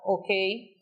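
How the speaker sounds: normal talking voice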